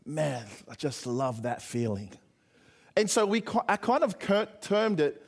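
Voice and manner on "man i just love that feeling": low, slow and soft, savoring it; slow, low sigh of contentment; satisfied teammate. Retold or imitated. Preacher quoting a boyhood friend after a Coke, slow and satisfied. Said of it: "one of the boys goes".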